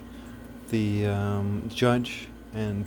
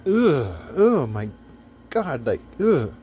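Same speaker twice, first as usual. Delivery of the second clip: exasperated groan; 3 semitones higher, 5 dB louder, slower than usual. Real, not acted. Exasperated rant about heroism.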